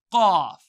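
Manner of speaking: loud mock cough. Shown as a demonstration. Teacher fakes a cough to teach qaf. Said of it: "I have a horrible cough".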